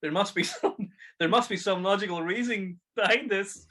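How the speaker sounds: high, hammed-up bad actor reciting; Arnold. Imitating the film hero frozen as the girl melts, overacted bewilderment. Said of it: "'Cause he says to her".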